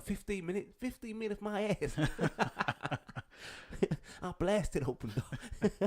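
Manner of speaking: hammed-up American outrage, laughing through it. Robber answering the clerk about the delay, mock American. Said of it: "when they talk American".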